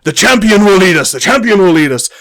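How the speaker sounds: rally the troops behind the champion; rousing cheer. Acted. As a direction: warriors hailing their champion. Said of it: "he goes".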